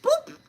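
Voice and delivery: a sudden high-pitched boop sound effect; a playful, very high boop as the bath water flies; squeaky boop. Retold or imitated. Sound effect as she throws.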